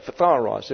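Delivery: Phtharos with an a in the middle; easier pronunciation. Shown as a demonstration. Teacher showing the easier Greek form. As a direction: say Phtharos easily. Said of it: "would be easier to say".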